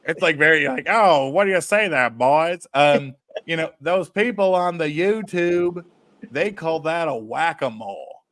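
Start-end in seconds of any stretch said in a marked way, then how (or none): 0.9-2.6 put-on a record dealer hailing two buyers in a Virginia accent. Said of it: "this is my Virginia accent". 3.8-8.2 put-on slow, light Virginia accent; the dealer explains YouTube slang in a mild Virginia accent. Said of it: "this is my Virginia accent".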